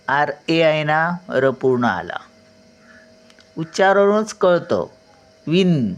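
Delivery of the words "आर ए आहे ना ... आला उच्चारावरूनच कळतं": ordinary talk, nothing marked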